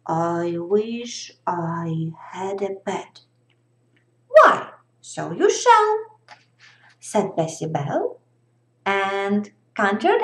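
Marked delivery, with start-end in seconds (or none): 0.1-3.2 low, soft mumbled wish. Acted. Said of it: "then mumbled". 4.3-6.2 high, louder eager fairy. Acted. Fairy granting wish.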